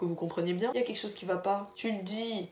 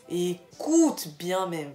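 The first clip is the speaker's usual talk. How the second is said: slow emphasis; 5 dB louder, much slower than usual. Real, not acted. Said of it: "Gars, écoute-moi bien".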